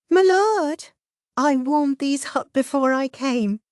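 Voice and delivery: high, chirping lady's voice; chirpy. A narrator's reading. Servant offering buns to Mao Mao, high chirp. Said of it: "she chirped".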